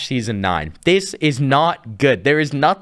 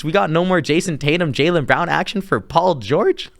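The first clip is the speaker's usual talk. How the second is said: ordinary talk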